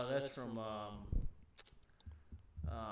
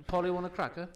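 higher, louder mock British; British accent attempt; 5 semitones higher, 5 dB louder than usual. Shown as a demonstration. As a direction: parrot phrase in a put-on British accent. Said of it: "do your best British accent".

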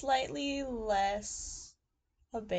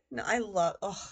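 ordinary delivery

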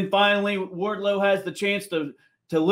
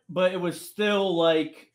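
ordinary talk

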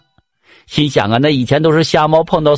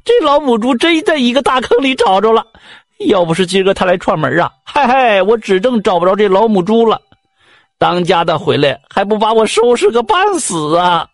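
excited shouting; 7 semitones higher than usual. Acted. Neighbor's wife shouting on the street about the found sow, high and thrilled. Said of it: "在大街上就吵吵把火说了".

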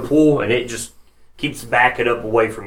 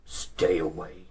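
low, quiet brute threat; menacing ogre voice; 3 semitones lower, 8 dB quieter than usual. Acted.

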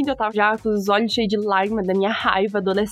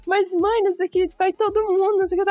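higher, pleading mock whine of a teenager; whiny teen pleading; 7 semitones higher than usual. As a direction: whine like a kid begging mom to go. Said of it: "Aí eu fiquei tipo". (adolescente choramingando para a mãe, voz aguda)

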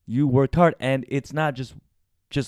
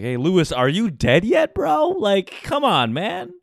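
high sneering taunt as the father; sing-song jeer at the son, pitch way up; imitating a taunting dad, high and teasing; mocking the father; 8 semitones higher, 4 dB louder than usual. Mocking abusive father taunting him.

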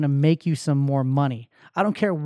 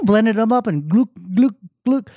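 gulping sounds; 3 semitones higher than usual. Pretend. Miming drinking a green smoothie.

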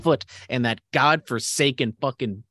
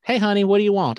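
higher, louder mock Southern greeting; Southern clerk; 9 semitones higher, 4 dB louder than usual. Retelling the liquor store clerk's greeting, higher and louder. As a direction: call it out like a friendly Southern clerk. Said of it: "I think that's what she said".

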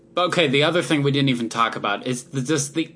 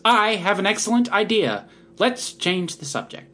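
imitating the March Hare; 8 semitones higher than usual. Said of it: "And he just goes".

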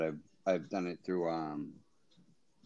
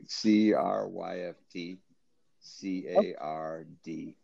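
slow spelling; slower than usual. Shown as a demonstration. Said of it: "just so everybody understands".

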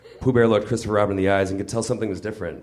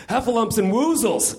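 much higher, squealing cry of delight; ecstatic exclamation; 13 semitones higher than usual. Acted. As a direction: burst out like a boy in ecstasy. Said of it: "Christopher Robin exclaimed".

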